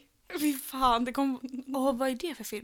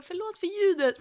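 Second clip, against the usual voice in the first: high, louder exaggerated sorry; mock apology; 8 semitones higher, 5 dB louder than usual. Mocking how they apologise to listeners for the sound. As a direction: ham up a sheepish podcaster apologising. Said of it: "Vi bara".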